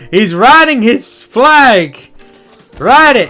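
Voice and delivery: high, slow, loud cheer; excited cheering. Real, not acted. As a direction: cheer him on as he charges. Cheering on a unit in game.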